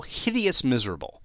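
plain reading voice

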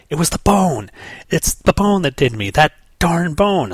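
high, loud ranting outburst, last words slowed; Guy blowing up. Acted. Guy yells at the Guardians, high and loud. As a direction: blurt it out like a furious hothead. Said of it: "he goes".

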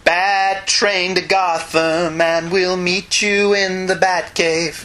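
very slow, deliberate reading; slow quote. Acted. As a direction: drag out each word. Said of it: "Robin makes reference".

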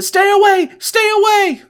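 loud, high-pitched acted scream; panicked scream. Young pilot screams at his attacker, acted loud panic. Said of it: "He screams at Jean".

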